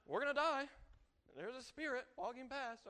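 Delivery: high, loud cry of doom; mock panic. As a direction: play a frightened man crying out. Acting out panicked disciples.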